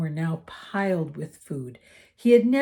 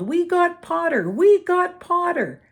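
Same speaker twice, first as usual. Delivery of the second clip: loud, higher, gleeful chant; twins yelling; 12 semitones higher, 8 dB louder than usual. A narrator's reading. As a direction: yell it across the table in triumph. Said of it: "the Weasley twins yelled".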